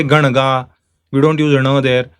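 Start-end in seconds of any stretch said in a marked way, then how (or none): 0.0-0.7 Ganga as gana-ga, with curled-tongue n; Ganga, hard n instead of ng; wrong retroflex nasal. Shown as a demonstration. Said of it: "We don't say".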